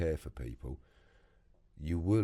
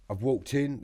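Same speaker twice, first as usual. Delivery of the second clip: normal talking voice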